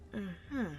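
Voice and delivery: quiet closed-mouth mm-hmm; soft mumble. Acted. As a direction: mumble agreement. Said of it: "you mumble".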